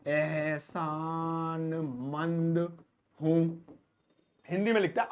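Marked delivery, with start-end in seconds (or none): 0.1-3.7 stretched long word. Shown as a demonstration. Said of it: "कितना लंबा है यार".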